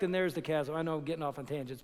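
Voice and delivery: ordinary delivery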